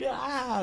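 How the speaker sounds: high, soft mock scream; held-back high retold wail; imitated panicked scream. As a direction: re-enact being dragged off screaming. Imitates scream while dragged by shark.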